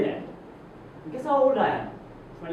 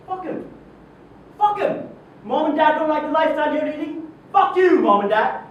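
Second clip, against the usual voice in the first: raised, higher-pitched angry defiance; defiant rant; 8 semitones higher, 5 dB louder than usual. Acted. Boss dismisses trainees' disapproving parents, defiant rant. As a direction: throw it out hard, scorning the doubters.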